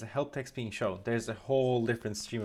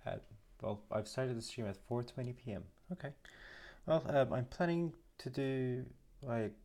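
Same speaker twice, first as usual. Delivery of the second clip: ordinary delivery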